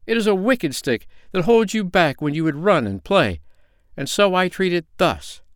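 high, indignant little man; mild storybook rage. A narrator's reading. Little man denounces the wicked crutch, mildly raging. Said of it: "in a passion of rage".

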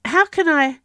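loud, high-pitched urgent question; pressing, urgent appeal. A narrator's reading. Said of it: "he asked urgently".

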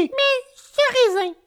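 very high insect voice; tiny firefly questioning. Acted. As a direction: ask it as a tiny squeaky moralist.

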